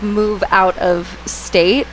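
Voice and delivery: ordinary talk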